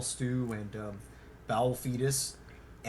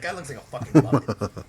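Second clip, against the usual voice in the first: louder, sneering insult; loud mocking; 9 dB louder than usual. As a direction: jeer it out loud. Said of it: "And he's like".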